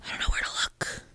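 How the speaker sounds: whispered, under the breath; soft unvoiced mumble of discomfort; embarrassed whisper. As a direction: whisper it as an awkward aside. Embarrassed aside about trolling.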